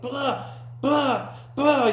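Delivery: high-pitched vocal blows; punchy mock hitting noises; imitated punch sounds. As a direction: act out each blow with a high burst.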